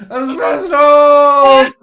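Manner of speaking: high, long-drawn bellowed cheer; shouted mock toast. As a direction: roar it out as a drunken toast. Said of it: "And I said".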